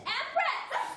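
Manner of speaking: high, loud call after the dog. Acted. Lady calls loudly after her vanished dog. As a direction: shout the dog's name into the distance.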